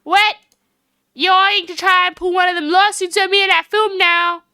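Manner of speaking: high, indignant Southern drawl. Acted. Woman suspects a lawsuit over the film, Southern drawl. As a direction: object in a loud, high Southern twang. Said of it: "I get to pull this voice off".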